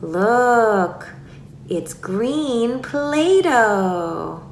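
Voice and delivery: "green Play-Doh" very slow and drawn out; slow, lengthened play talk; stretched-out words. Shown as a demonstration. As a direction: show a toddler, stretching each key word. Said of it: "stretch out words".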